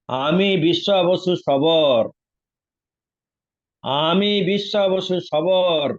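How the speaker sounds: a retold grand self-introduction; solemn proclamation. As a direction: announce the name like a revelation. Figure proclaims his identity.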